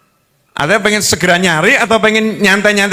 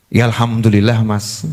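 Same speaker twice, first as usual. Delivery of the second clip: istri solihah; 12 semitones lower than usual. Acted. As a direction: answer the husband calmly, much lower. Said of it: "tidak berkeluh kesah". (istri menjawab pelan, pasrah, bernada rendah)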